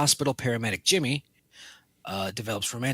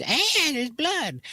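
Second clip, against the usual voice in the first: high, slow squealing surprise; mock shocked squeal; much slower than usual. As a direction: squeak it out, hammed-up. Acting out killer finding blood.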